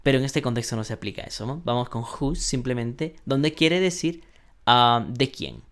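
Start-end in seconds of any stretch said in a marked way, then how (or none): none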